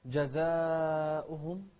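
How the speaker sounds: جزاؤهم chanted slowly with a long "zaa"; drawn-out chanted جزاؤهم; four-count madd. Shown as a demonstration. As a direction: stretch the a of جزاؤهم before the hamza. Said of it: "ننتبه للمد هني".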